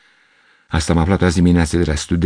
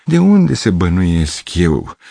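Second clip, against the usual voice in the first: self-pitying musing; 6 semitones higher than usual. A narrator's reading. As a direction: sigh over the poor girl. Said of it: "mi-am spus".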